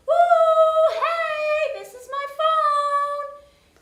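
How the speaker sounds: very high, loud, drawn-out sung call; high-pitched yelling. Retold or imitated. High-pitched call to girls holding her lost phone. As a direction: squeal it high and slow so they hear. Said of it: "making all this high-pitched noise".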